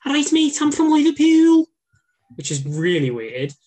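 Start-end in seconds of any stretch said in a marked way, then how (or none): 0.1-1.7 very high, loud Scouse; hammed-up strong Liverpool accent; Liverpudlian accent. Shown as a demonstration. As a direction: pitch it way up in Scouse. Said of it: "like, a Liverpudlian accent".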